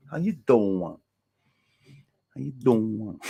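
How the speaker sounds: mock New York "How you doin'"; New York accent. Streamer showing how he greets Lee, playful New York accent. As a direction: put on a New York accent, stretching "doing". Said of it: "his New York accent".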